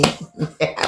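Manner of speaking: evil gleeful chuckle; sadistic laugh. Pretend. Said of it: "le rire, euh, sadique".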